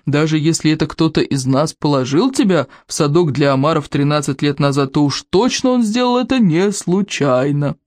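high, swooping, theatrical scorn; amused chief condescendingly explaining; mocking berserker chief. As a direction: relish each insult with exaggerated lilt.

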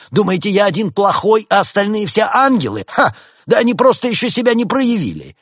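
high, loud, outraged tirade; scornful, indignant rabbit doctor; indignant protest. A narrator's reading. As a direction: protest shrilly against being singled out. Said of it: "возмутился кролик".

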